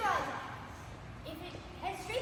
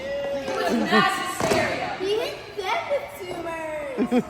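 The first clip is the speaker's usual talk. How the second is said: dramatic; 8 dB louder, slower than usual. Acted. Dramatic slow emphasis.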